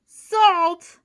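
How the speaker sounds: very loud, high cry of horror; alarmed shout. A narrator's reading. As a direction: yell it in sudden alarm. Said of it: "shouted the giant slug".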